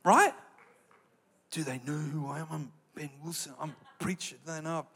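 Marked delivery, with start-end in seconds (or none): 1.5-4.9 soft, smug self-important voice; mocking his own ego, softer and smug; mock arrogant preacher. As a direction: pretend to be full of yourself. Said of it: "I'm just joking".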